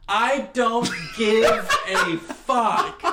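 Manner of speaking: imitated fed-up dad shouting; mock exasperated outburst. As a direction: hammer out each word, stretched. Retold exasperated dad's outburst.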